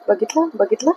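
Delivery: soft, hushed repeated question; quiet aside. Real, not acted. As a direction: drop to a murmur, as if not to be heard. Quiet aside about the cat.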